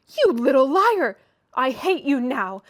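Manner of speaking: loud, slow, breathless fury; panting with rage. A narrator's reading. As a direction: gasp it out in fury. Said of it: "she panted".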